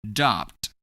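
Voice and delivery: "Dropped" as a demo of ED said as D; one syllable "Dropped", meant to end in D; ED pronounced as D. Shown as a demonstration. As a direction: model "Dropped" with the ED as a single D sound. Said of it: "we pronounce E D as D".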